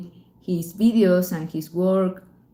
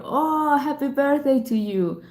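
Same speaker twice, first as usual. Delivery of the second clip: excited birthday wish; 7 semitones higher than usual. Real, not acted. Greeting a viewer's birthday.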